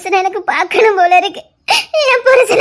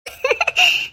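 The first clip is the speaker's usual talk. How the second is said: gloating cackle; 9 semitones higher, 3 dB quieter than usual. Acted. Schemer gloats over plan.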